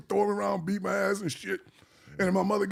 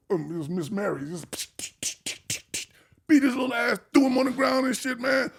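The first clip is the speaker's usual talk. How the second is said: ordinary delivery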